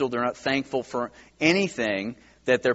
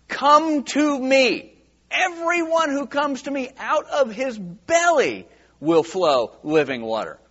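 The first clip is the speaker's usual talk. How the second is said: calling out; 9 semitones higher, 3 dB louder than usual. Retold or imitated. Preacher quoting Jesus at the feast, calling out.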